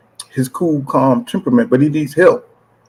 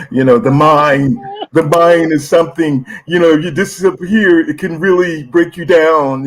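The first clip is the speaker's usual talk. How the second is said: mimicking AJ; 3 semitones higher than usual. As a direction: drift it out like a guru. Imitating AJ talking like cult.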